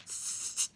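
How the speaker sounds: soft unvoiced hiss through teeth; hissing sound. A narrator's reading. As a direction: hiss like a leaking tire. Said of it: "a high-pitched hissing sound".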